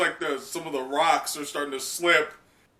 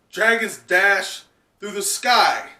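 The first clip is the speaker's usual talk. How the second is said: slow, louder, higher proclamation; rousing Yamato; 6 semitones higher, 7 dB louder, slower than usual. Acted. Yamato inspiring Momo to fly, slow and rousing. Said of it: "But Yamato says".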